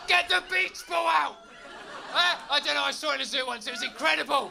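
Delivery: high, loud laughing glee; overjoyed at finding a seal at home; giddy excited rant. Acted. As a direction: gush with delight, almost shouting.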